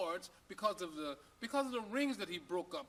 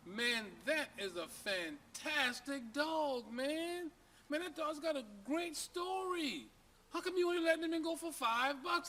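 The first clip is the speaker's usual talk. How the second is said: high, drawn-out amazement; high and slow, wowed buyer; amazed; 7 semitones higher, slower than usual. Acted. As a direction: gush over the great dog. Amazed buyer praises dog.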